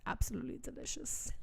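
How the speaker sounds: hushed, savouring murmur; flirtatious half-whisper. Acted. Reese's note to Feyre, seductive half-whisper. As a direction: breathe it out seductively.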